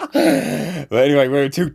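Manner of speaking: high laugh, slow giggly words. Real, not acted. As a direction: laugh through it. Laughing at own prank.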